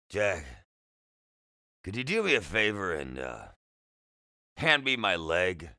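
halting, slurred patient with a rasp; raspy, slurred voice. A narrator's reading. Patient asking the boy for a favor, raspy and slurred. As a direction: hesitate through a slurred, raspy request. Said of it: "He spoke with a raspy, slurred voice".

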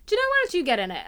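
quoting a friend's eager invitation; imitated urging.

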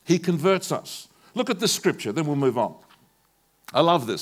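loud, ringing pulpit voice; fervent preacher lifting the crowd; full-force preaching. Acted. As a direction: preach it out to the whole hall.

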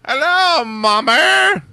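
high, loud mock Scottish holler; bad Scottish accent. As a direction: shriek it in a terrible Scottish accent. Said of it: "that's my Scottish accent".

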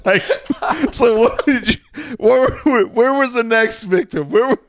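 real laughter breaking into each word; giggling while teasing a friend; laughing. Real, not acted. Laughing at friend's food story.

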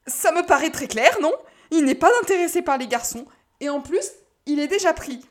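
high, loud, upset arguing; worked-up, insistent objection; agitated protest. Acted. Upset twin argues he is unavailable.

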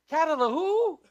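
loud, high, laughing double take; amused disbelief at the odd name; puzzled laugh. Retold or imitated. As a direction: stumble over the name with a laugh. Mock confusion over the name.

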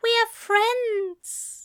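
high, slow, tearful voice; high-pitched, wavering hurt; near tears. A narrator's reading. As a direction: say it holding back tears. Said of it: "burning with the threat of tears".

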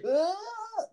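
high yelp; startled yelp. Shown as a demonstration. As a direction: cry out as you cling on. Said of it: "do your reaction".

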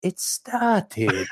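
high, breathy quoted line; Mister Dursley impression. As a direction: squeak it out like a fussy Englishman. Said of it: "it went with us for about three or four episodes".

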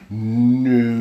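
a loud, flat-out No; emphatic no. Retold or imitated. Man refusing the hard option, loud emphatic No. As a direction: slam the door with one loud No. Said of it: "I'm gonna say it just like this".

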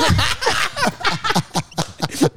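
high, drawn-out laughing fit; long laugh. Real, not acted. Friends joking over "trimestrales", long high laugh. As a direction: crack up in a high voice. Joke about the word trimestrales.

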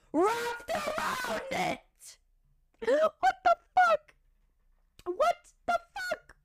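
high, slow tearful wail; sobbing high what the fuck; crying outburst. Real, not acted. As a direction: cry out in disbelief. Reliving horror, crying.

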